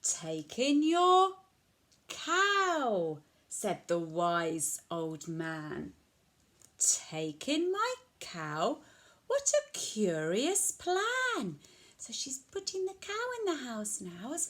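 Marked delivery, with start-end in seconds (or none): 0.0-11.6 lilting picture-book storytelling with sweeping pitch; reading to children. A narrator's reading. Old man advises puzzled old lady, animated storytelling. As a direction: read it out clearly for a small child.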